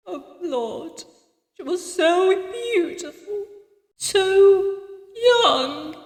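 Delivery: put-on old woman close to tears, halting; voice cracking. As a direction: lament haltingly, grief welling up. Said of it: "and her voice cracked".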